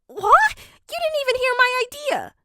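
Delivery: very high, loud offended cry; cut-off dad protesting, very high and loud; indignant squawk. Acted. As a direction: squeal it out, wounded and offended. Hisashi outraged at being cut off.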